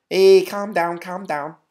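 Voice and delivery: high-pitched mock Scouse accent; Scouser accent. Shown as a demonstration. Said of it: "can you do some Scouser accent?".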